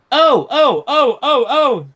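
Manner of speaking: high, loud squealing cries of startled trucks; screaming trucks. A narrator's reading. Trucks shriek as Edward pulls them, high and loud. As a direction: shriek like toy trucks being yanked. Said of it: "screamed the trucks".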